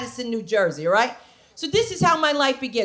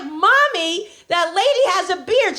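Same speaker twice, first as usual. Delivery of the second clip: put-on higher little-girl voice; child voice; 6 semitones higher than usual. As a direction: pipe up like a curious kid. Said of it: "I'm like".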